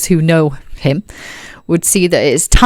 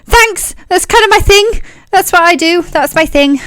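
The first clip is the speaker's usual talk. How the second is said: very high, chirpy and pleased; high Tyne voice; 12 semitones higher than usual. Acted. Tyne thanks the party for the compliment, chirpy. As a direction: brag cheerfully in the high Tyne voice.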